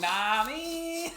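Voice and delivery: mock drawn-out voice. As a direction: stretch it out as a joke. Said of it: "Aw, shit".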